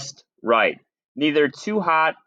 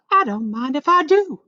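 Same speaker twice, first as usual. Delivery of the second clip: help yourself with cheeky glee; high cheeky girl; Goldilocks helping herself; 13 semitones higher than usual. A narrator's reading. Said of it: "she said".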